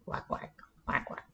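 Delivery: whispered duck quacks; soft quacks. Acted. A duck paddling by talks softly to itself, whispered quacks. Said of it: "talking softly to itself".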